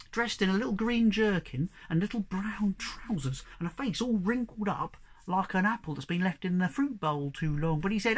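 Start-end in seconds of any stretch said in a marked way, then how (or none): none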